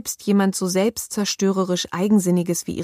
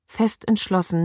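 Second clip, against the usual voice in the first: plain reading voice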